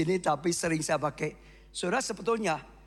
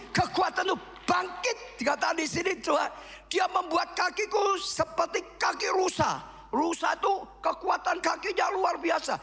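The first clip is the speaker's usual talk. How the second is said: preaching at full force; 10 semitones higher than usual. Acted. Pastor shouts encouragement to the congregation, full fervour. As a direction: preach it out with fire.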